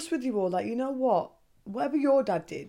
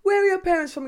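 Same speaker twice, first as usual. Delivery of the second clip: high, loud nosy question; nosy coworker; 10 semitones higher, 6 dB louder, faster than usual. Retold or imitated. New coworkers asking about her parents, high loud prying. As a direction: play the curious new colleague, up high and loud. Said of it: "they're like".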